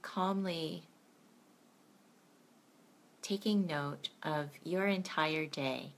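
gentle bedtime meditation guide; guided meditation. Acted.

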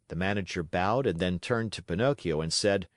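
ordinary delivery

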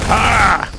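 high, loud "ah"; sudden yell at an attack; startled scream. Real, not acted. Startled in game.

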